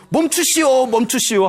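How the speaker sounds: acted urgent plea to stop; calling a halt. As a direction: call out to halt the coachmen. Same acted call to halt.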